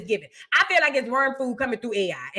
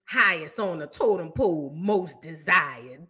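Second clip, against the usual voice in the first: lower, very slow mocking echo; scornful slow mimic; mock haughty; 10 semitones lower, 5 dB quieter, slower than usual. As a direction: drag her words out low to mock her. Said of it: "I know who this is".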